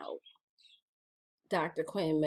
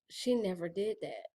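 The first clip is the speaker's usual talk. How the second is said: usual speaking voice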